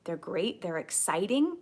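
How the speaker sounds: ordinary delivery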